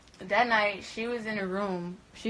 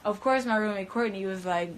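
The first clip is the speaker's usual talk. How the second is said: ordinary talk, nothing marked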